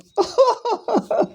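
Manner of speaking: long, high-pitched laugh; amused laugh. Real, not acted. Speaker recalling his roommate's odd logic, amused laugh. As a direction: burst out laughing at the absurdity.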